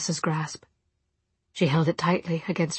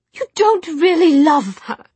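cutting retort; 11 semitones higher, 9 dB louder than usual. Acted. Jessamine arguing.

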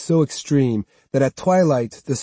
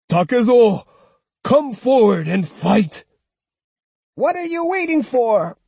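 challenging call; 10 semitones higher, 4 dB louder than usual. Acted. Old woman calling the swordsman forward, high and loud. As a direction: call him out to fight.

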